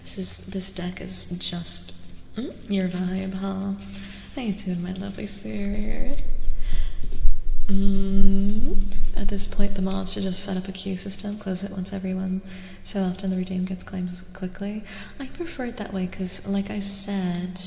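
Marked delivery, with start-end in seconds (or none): none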